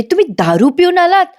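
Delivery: high-pitched retold shocked question. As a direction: voice the wife's disbelief, pitch leaping up. Wife shocked at drunk husband, retold.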